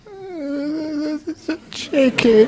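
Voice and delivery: hammed-up sobbing protest; mock crying. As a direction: whimper it out as the tearful wife. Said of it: "that's when she started to cry".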